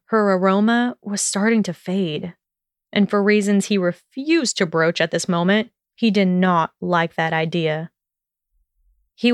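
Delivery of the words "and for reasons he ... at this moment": usual speaking voice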